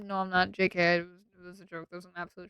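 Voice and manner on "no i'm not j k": ordinary delivery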